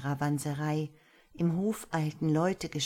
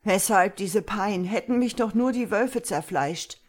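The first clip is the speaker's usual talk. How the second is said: louder, higher despairing lament; anguished plea, pitched up; 4 semitones higher, 6 dB louder than usual. A narrator's reading. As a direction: cry out in despair, without sobbing. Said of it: "stammelte verzweifelt".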